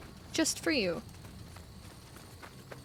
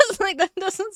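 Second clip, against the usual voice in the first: laughing; 9 semitones higher than usual. Real, not acted. Laughs at own mistake.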